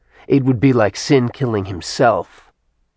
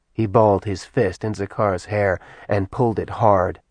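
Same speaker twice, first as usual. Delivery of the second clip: ordinary delivery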